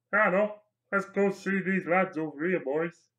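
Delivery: chummy put-on voice calling the gang along; Andy orc. Andy orc inviting the boys over, put-on voice. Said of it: "Could even have an Andy orc".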